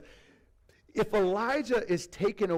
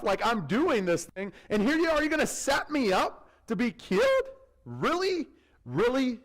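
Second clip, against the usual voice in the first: higher, louder, growing indignant complaint; exasperated Obadiah; 6 semitones higher, 4 dB louder than usual. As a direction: plead your case, building to disbelief. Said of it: "He starts going through his resume".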